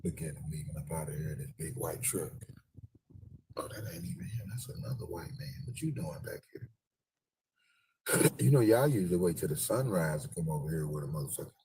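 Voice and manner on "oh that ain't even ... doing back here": hushed aside; whisper. Real, not acted. Filming a stranger, whispered aside. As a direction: whisper so the man won't hear. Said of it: "whispering while filming".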